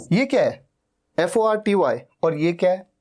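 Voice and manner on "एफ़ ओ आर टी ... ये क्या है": normal talking voice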